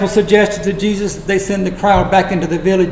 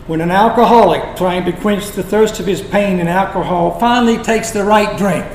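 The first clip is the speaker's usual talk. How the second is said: usual speaking voice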